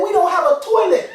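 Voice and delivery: high, loud outraged mother; alarmed mom. Acted. Mom telling her son the toilet is gone, alarmed. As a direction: exclaim it high in disbelief.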